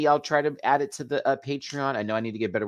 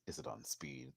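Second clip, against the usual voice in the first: much lower, softer mumbled repeat; deadpan low; 10 semitones lower, 23 dB quieter than usual. Pretend. Vlogger repeats her joke deep and soft, then doubts it. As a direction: drop it low and quiet.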